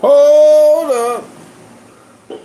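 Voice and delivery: loud, stretched-out "hold up"; loud drawn-out call. Real, not acted. Halting the talk to look up a name, loud drawn-out call.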